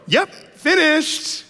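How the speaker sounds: put-on high, slow, drawn-out kid voice; son's smug reply. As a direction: stretch it out like a pleased child. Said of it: "my son yell down the hallway".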